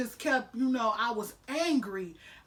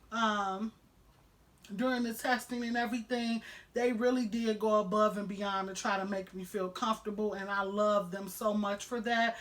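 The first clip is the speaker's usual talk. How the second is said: ordinary delivery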